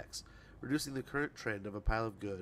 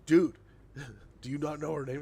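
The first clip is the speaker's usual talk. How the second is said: brother's disbelief; 4 semitones higher, 7 dB louder than usual. Retold or imitated. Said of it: "he'd be like".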